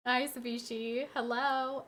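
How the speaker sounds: ordinary talk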